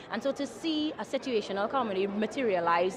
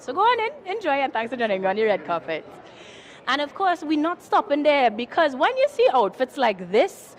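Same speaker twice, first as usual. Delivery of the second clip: bubbly red carpet host; gushing red carpet chatter; 2 semitones higher, 5 dB louder than usual. Acted. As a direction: gush like an entertainment presenter.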